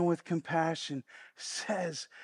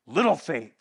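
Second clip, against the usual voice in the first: lower, louder scolding "Little faith"; angry Jesus; 6 semitones lower, 4 dB louder than usual. Shown as a demonstration. Preacher showing the wrong reading, Jesus scolding his disciples, angry. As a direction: hurl "Little faith" like a harsh rebuke. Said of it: "angry Jesus saying".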